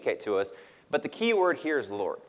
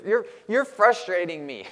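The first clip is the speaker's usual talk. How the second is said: imitating toddler daughter; 5 semitones higher than usual. Dad voicing daughter to sister.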